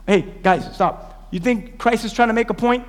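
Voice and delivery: ordinary talk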